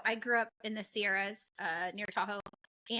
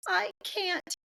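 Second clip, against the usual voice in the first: son whining; 10 semitones higher than usual. Retold or imitated. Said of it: "and he goes".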